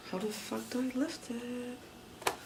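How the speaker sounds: high, soft, drawn-out whine; baffled whine. Real, not acted. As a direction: slowly whine it upward, stuck and puzzled. Stuck trying to lift part.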